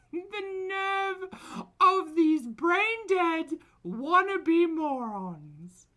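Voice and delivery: high, loud, slow taunting screech; mock her like a shrieking online bully; foodie voice. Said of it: "in foodie voice".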